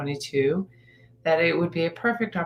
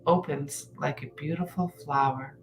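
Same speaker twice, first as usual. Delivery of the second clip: unhurried guided-meditation delivery with long pauses; calm, measured phrases, letting each one settle. Acted.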